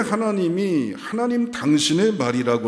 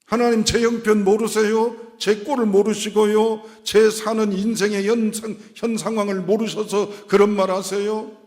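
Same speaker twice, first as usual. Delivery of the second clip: high, insistent string of questions; indignant believer questioning God; protesting to God; 10 semitones higher, 5 dB louder than usual. Acted. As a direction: plead your case, pitched high and a little louder.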